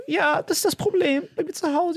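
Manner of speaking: very high, put-on poor-child whimper; fake pitiful voice. Boy playing a rejected son to the guard, fake pitiful voice. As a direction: plead high, badly faking hurt.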